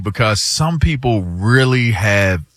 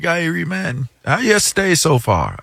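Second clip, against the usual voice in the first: a light put-on Jamaican lilt, pitched higher; imitating a Jamaican accent; 6 semitones higher than usual. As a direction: mimic the pilot's Jamaican greeting, higher.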